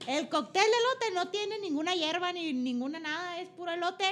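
hammed-up Sonoran accent. Explaining Sonoran corn cocktail to her co-host in a thick Sonoran accent. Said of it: "¿Alguna vez hablaste así o estás mamando?".

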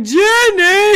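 very loud, high drawn-out name call; echoing someone else's bellowed shout; imitated yell. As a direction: holler it high and long. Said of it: "another guy's like".

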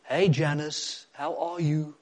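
imitated Afrikaans accent, drawn-out greeting; slow, put-on Afrikaans accent. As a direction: greet slowly, mimicking an Afrikaans neighbour. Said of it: "In an Afrikaans accent".

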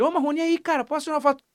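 high-pitched, hopeful approach; eager fan request. Retold or imitated. Fan asks the singer for a photo, eagerly. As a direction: call out and ask like a keen fan.

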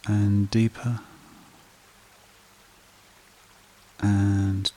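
slow meditation voice with long pauses; guided meditation. Acted. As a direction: guide listeners gently into calm, phrase by unhurried phrase.

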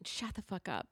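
soft, tired shut up; quiet weary dismissal. Real, not acted.

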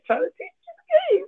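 high, fast squeal; squeaky high voice. Pretend. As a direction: squeak it out playfully. Playful emphasis.

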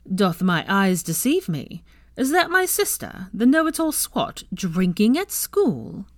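teasing. Acted. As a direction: tease her with mock astonishment. Theo teasing his sister.

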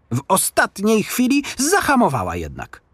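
plain reading voice

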